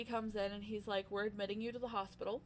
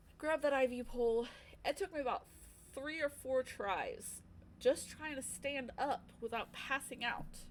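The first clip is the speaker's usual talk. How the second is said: normal talking voice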